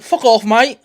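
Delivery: loud, high, hammed-up English accent; exaggerated British accent, punchy and high; mock English accent. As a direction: shout it like a cartoon Englishman. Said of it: "they have all these accents".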